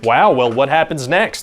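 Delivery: ordinary talk, nothing marked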